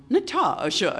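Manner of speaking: imitating the cartoon Russian accent; Russian woman's voice. Recalling Rocky and Bullwinkle, a mock Russian woman calls out. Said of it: "that Russian woman's voice like that".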